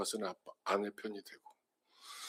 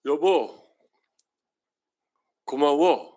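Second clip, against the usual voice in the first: a higher, louder thank-you to a spouse, lightly acted; tender thanks to wife; 3 semitones higher, 6 dB louder, much slower than usual. Shown as a demonstration. Pastor showing the thanks a husband owes his wife, heartfelt. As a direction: say it like you finally mean it. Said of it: "라는 이 말이".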